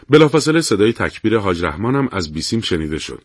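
plain reading voice

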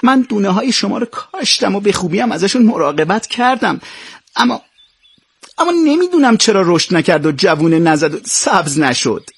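put-on high-pitched, tearful child's protest; tearful reply. A narrator's reading. Ping tearfully answers the emperor about the empty pot, high and pleading. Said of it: "پینگ با گریه جواب داد".

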